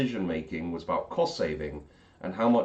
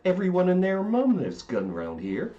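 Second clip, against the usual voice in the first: jokey, lightly hammed West Country accent, higher; mock West Country accent; 11 semitones higher than usual. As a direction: toss it off in a playful West Country voice.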